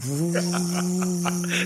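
long, steady "vroo" hum; computer fan whir. Retold or imitated. Podcaster describing his computer while editing, imitated fan whir. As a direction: imitate a fan spinning up and holding.

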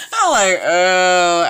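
cracking up, drawn out and loud; laughing. Real, not acted. Laughing at her own joke to viewers, loud. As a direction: collapse into laughter mid-sentence.

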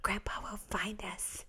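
soft hiding whisper. Retold or imitated. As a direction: hush the little ones so nobody finds you. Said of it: "We'd, we'd say".

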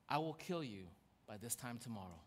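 low, hushed menace; quiet threat. Retold or imitated. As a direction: deliver the threat softly, dropped right down. Jezebel's threat read from her letter.

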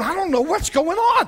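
high-pitched bewildered outburst; comically confused God. Acted. God up in heaven, confused by repeated apologies, bewildered. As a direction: play it high and hopelessly puzzled. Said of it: "you confused God".

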